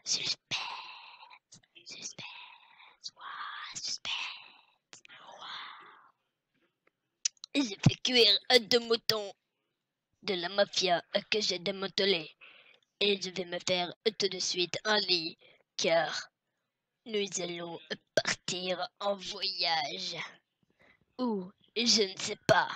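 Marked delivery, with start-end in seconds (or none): 0.0-6.1 suspense whisper. Acted. Whispers after "quel suspense. 17.1-20.2 mysterious whisper. Acted.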